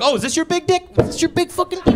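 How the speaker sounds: high-pitched, hammed-up challenge; mock taunting. Imagined confrontation with other men.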